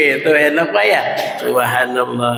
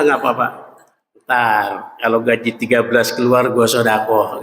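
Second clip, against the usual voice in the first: lower, offhand mimic of a reluctant giver; lazy excuse-maker; 9 semitones lower than usual. Preacher mocks people who put off giving, lower offhand voice. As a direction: shrug it off like someone dodging charity. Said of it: "orang kalau mau sodako bilang".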